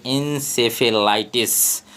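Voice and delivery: encephalitis spoken slowly, syllable by syllable; careful pronunciation. Shown as a demonstration. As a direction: say encephalitis slowly and clearly so the class hears every part. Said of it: "मैं यहाँ पे बता देता हूँ".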